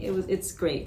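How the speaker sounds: usual speaking voice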